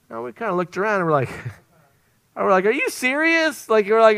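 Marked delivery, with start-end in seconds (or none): 2.7-3.7 very high-pitched, incredulous question; retold wide-eyed amazement; astonished disbelief. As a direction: act out stunned diners, pitch leaping up. Diners astonished at free meal.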